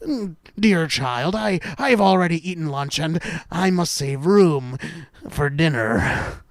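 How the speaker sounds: wolf lightly disguised as an ailing grandmother; false grandmother refusing. Acted. Wolf declining food with sly intent, false grandmother voice. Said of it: "but grandmother refused, saying".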